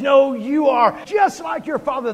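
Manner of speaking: loud, high shouted rebuke; acted shout. Jesus shouting at the religious people, a furious rebuke.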